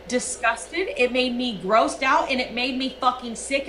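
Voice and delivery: normal talking voice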